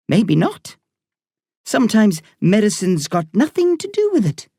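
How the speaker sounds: put-on Irish woman doctor talking to a boy patient. A narrator's reading. Doctor voiced in story.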